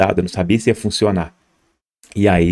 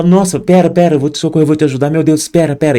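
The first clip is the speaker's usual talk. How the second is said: higher-pitched flustered rush to help; imitated nervousness; 5 semitones higher than usual. Woman promising to help an injured stranger, imitated nervous fluster. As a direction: act out her nervous, urgent reassurance. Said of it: "E ela nervosa".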